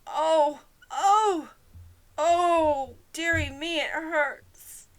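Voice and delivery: high, loud moaning oh's; pained wailing. A narrator's reading. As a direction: moan as if already hurt. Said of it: "the poor Queen groaned".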